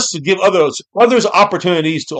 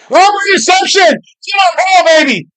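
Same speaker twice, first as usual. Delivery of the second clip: high, loud play-call yell; excited shout; 5 dB louder than usual. Real, not acted. Host calling an interception by Keemon Hall, excited shout. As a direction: holler it like a big interception call.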